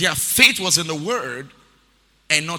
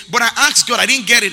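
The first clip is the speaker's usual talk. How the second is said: louder, higher whine of a disappointed believer; mock complaint; 7 semitones higher, 11 dB louder, faster than usual. Preacher mimics a believer complaining to God, loud and higher. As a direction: act out the believer who feels cheated.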